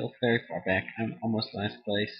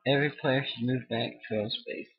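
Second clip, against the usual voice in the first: ordinary delivery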